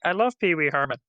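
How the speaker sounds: a hint of a giddy kid's put-on voice; Pee Wee Herman voice. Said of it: "talking with this high-pitched voice".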